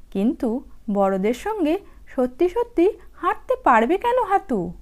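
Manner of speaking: ordinary delivery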